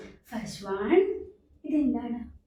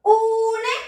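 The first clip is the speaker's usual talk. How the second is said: ഊണ് called out high and loud; louder model word; 8 semitones higher, 5 dB louder, much slower than usual. Shown as a demonstration. Teacher to class, asking them to say it louder, loud. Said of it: "എല്ലാവരും ഒന്ന് ഉറക്കെ പറഞ്ഞേ".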